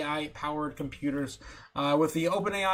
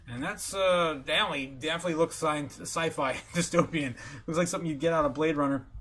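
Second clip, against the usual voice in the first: usual speaking voice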